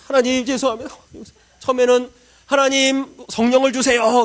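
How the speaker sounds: high, loud earnest prayer; fervent prayer. Retold or imitated. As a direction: cry out to God. (뜨겁게 외치는 기도)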